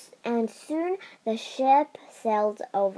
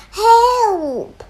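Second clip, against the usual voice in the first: louder, higher cry for help; shouted; 10 semitones higher, 9 dB louder than usual. Acted. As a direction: yell it out again. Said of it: "they all shouted".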